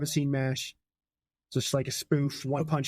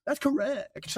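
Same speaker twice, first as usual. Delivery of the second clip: high-pitched, playful echo; silly high voice; 11 semitones higher, slower than usual. Pretend. Host repeating the score confirmation, silly high voice. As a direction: repeat it in a squeaky put-on voice.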